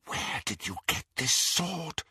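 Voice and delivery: soft, high Bellatrix whisper. A narrator's reading. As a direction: hiss the question softly. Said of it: "she whispered".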